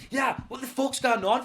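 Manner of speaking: mock bravado. Driver trying to be brave with his hijacker, mock bold. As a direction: put on bravery while scared. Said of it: "I'm gonna be brave".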